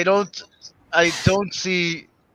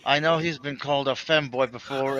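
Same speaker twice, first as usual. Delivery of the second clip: usual speaking voice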